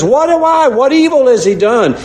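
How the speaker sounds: Pilate baffled, pitch leaping up; Pilate protesting. Retold or imitated. Preacher voices Pilate asking the crowd, high and pleading. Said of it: "he says".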